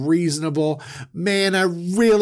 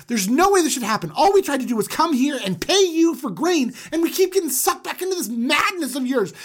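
high, rushed desperate plea; frantic pleading; 9 semitones higher, 4 dB louder than usual. Retold or imitated. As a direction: beg in a panic.

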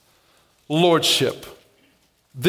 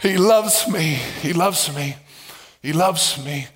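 fading tender repeat; 4 semitones lower than usual. Real, not acted. Softly repeated affirmation.

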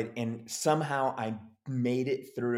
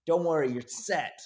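higher-pitched producer promising stardom; big-shot producer; 6 semitones higher, 3 dB louder than usual. Retold or imitated. Producer promises young actor stardom, big-shot assurance. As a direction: hand down a confident showbiz promise.

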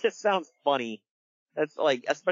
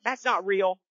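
retold angry protest; 7 semitones higher than usual. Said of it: "they get angry at him".

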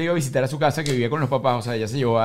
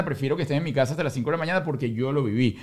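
usual speaking voice

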